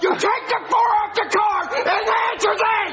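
furious yelling. Acted. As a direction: yell it as the final word. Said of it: "heard him yell at us".

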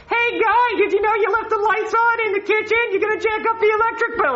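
nagging wife. Retold or imitated. Cop mimicking his nagging wife in a high voice. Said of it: "she's gotta yell at me all day like".

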